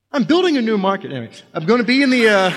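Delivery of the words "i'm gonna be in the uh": ordinary talk, nothing marked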